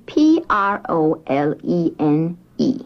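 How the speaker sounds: P R O L E N E, each letter said separately; spelled out letter by letter. Shown as a demonstration. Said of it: "That's capital".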